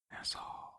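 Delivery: "Nassau" with the final vowel stretched out; elongated last syllable. Retold or imitated. Repeating the character's way of saying NASA, "Nassau" ending drawn out. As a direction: linger on the end of "Nassau". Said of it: "elongates the last syllable".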